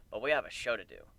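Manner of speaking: ordinary delivery, nothing marked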